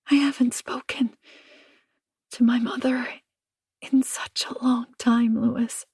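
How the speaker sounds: high, broken whisper. Acted. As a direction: whisper it, ashamed. Anna whispering on the phone.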